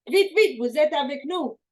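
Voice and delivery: high, loud, urgent French; urgent French call. Retold or imitated. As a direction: hurry them along in French, calling out. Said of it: "And he said".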